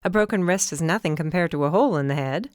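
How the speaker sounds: usual speaking voice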